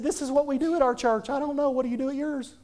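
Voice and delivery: high, matter-of-fact child imitation; a little boy's voice, pitched well up; six-year-old boy. Son explaining his church's ways to someone, high child impersonation. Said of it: "Here's a six-year-old boy saying".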